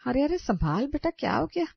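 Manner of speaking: high, worried cry; startled concern as she falls; alarmed. Acted. Mother-in-law to fainting Sonam, high alarmed cry.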